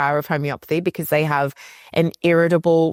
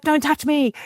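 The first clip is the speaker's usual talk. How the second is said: high, sharp mock rebuff; hammed-up irritated snap; snapping laboring woman; 10 semitones higher than usual. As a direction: push the partner away with words. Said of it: "She's like".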